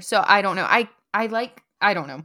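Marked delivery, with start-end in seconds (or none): none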